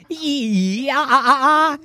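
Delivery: excited monkey voice. Acted. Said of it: "the monkey Stuffy said".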